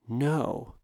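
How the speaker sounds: soft defiant whisper. A narrator's reading. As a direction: breathe the refusal quietly. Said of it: "The man whispered".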